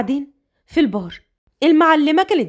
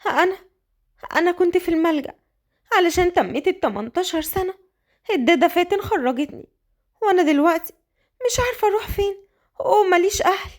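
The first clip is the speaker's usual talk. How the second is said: put-on higher, innocent orphan girl voice; naive young girl; 5 semitones higher than usual. Orphan Qadr telling Sosan she has nowhere to go, higher lost voice.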